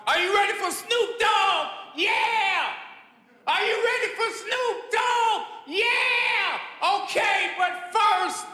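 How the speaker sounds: high, shouted crowd warm-up; hype MC. Retold or imitated. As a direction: imitate an MC whipping up the room at full shout. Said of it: "M C came out".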